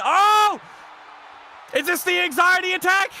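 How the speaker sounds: loud, high surprised outburst; jolted by a move; shocked shout at the game; startled yelp. Real, not acted.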